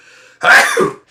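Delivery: one loud, sudden real sneeze; a sharp sneeze bursting out; sneezing. Real, not acted. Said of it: "excuse me, I'm gonna sneeze".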